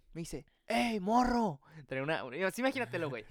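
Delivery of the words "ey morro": high-pitched call from afar, held back in volume; distant shout. Retold or imitated. Stranger hailing a lost kid from the back of the bus, restrained shout. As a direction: call out like a man yelling from the back. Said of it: "un señor me grita al fondo".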